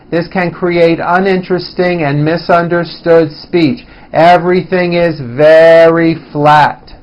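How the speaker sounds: flat intonation, no rise or fall from "Many" to "flat"; monotone. Shown as a demonstration. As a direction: hold "uninteresting and misunderstood speech" on one level pitch. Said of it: "you can see from that example".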